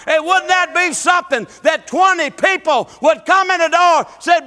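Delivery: loud, rising revival preaching; preaching at full force. Acted. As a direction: preach it hard to the congregation.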